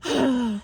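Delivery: imitated huff of breath; huffy sigh. Girl huffing in bed to be noticed, mock huffy sigh. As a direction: sigh as if put out. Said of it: "gets a huffing and puffing".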